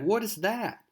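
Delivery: pitched far up in sudden alarm; startled question. Retold or imitated. Mary Jane hearing the explosion, startled and high. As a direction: jolt up high as if something just blew up.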